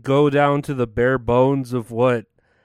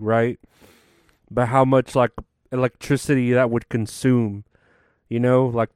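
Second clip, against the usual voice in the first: normal talking voice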